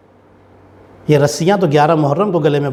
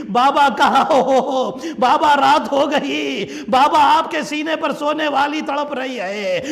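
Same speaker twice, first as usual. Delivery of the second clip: calling for father; 11 semitones higher than usual. Acted. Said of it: "آواز دے رہی تھی".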